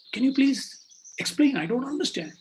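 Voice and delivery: high-pitched, baffled request; confused pleading. Acted. Confused king asking the child to explain, pleading. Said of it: "The king is confused".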